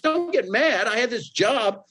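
very high-pitched incredulous echo; indignant retort. Retold or imitated. Job seeker answering the boss who took his job, indignant. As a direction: throw it back in mock outrage, voice way up.

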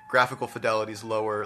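normal talking voice

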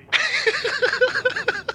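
high breathy giggle. Pretend. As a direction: giggle helplessly. Pilot giggle.

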